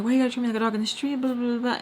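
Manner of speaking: high, rushed mocking imitation; complaining boyfriend. Girlfriend mocking boyfriend's complaint, high rushed imitation. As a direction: mimic his complaint fast and dismissively. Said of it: "he's like".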